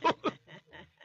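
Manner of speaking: sudden high amused laugh; high burst of laughter. Real, not acted. Laughing at his own old reaction to her accent. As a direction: laugh out loud at the memory.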